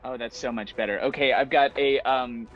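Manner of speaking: ordinary talk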